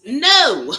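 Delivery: loud, high no; emphatic no. Real, not acted. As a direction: shout a firm no. Emphatic refusal after laughing.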